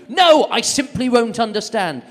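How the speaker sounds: high, loud, slow stubborn mum. Retold or imitated. Mum voiced in story.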